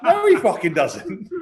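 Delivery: laughing, slow mock denial; laughing disbelief at the translation; laughing retort. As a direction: laugh through the comeback. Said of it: "I was like".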